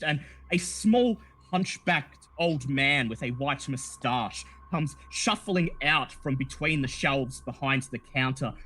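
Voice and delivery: ordinary delivery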